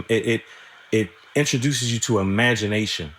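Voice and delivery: ordinary talk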